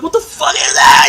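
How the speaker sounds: high, loud mock disbelief; incredulous outburst. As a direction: cry out as if you can't believe that voice. Acting out his shocked reaction.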